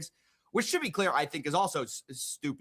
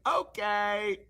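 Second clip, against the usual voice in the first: mock okay; 5 semitones higher than usual. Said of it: "The guy's like".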